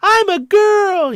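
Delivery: put-on very high, loud, drawn-out falsetto girl; silly high mock-girl voice, stretched out; over-the-top female voice. Shown as a demonstration. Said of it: "a male doing a female voice".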